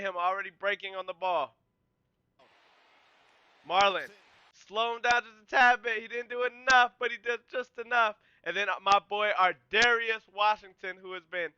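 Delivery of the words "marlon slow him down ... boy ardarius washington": excited commentary. Real, not acted. Excited play call of teammates.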